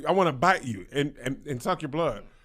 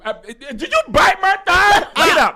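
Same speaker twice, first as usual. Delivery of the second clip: high, loud panicked "Get up" while laughing; alarmed shout; 10 semitones higher, 9 dB louder, slower than usual. Acted. A woman shoving off a biting lover, alarmed shout. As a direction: act shocked at being bitten.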